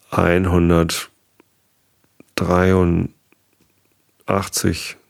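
slow, stretched-out counting; hesitant, dragged count; drawn-out number. Real, not acted. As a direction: draw the number out while working it out. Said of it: "einhundertdreiundneunzig Episoden".

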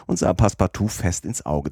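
ordinary delivery, nothing marked

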